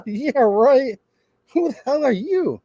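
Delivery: high, mock-incredulous retort; acted indignant dismissal; scoffing disbelief. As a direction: scoff at the self-important clerk. Customer scoffs at tyrant clerk.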